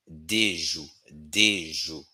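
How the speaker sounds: "Des jours" repeated, with "Des" like "day"; Haitian Creole pronunciation. Shown as a demonstration. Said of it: "Haitian Creole pronunciation".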